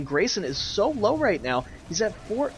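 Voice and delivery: ordinary talk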